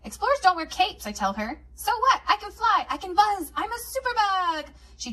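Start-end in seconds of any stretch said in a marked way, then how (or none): 1.7-4.7 put-on bold toddler. A narrator's reading. Little sister in a cape boasting to her sister, high and defiant. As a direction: show off like a tiny superhero.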